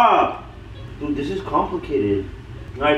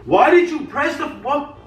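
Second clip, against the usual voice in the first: angry yell; 10 semitones higher than usual. Real, not acted. Angry at partner.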